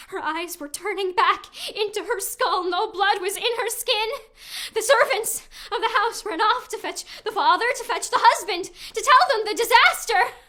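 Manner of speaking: building from urgent to loud; rising alarm. Acted. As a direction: let the panic climb. Horror builds in the report.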